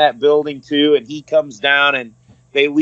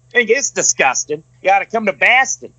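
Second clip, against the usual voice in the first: imitating a drunk guy's thick Boston accent, a bit higher; 5 semitones higher than usual. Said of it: "hardcore Boston accent".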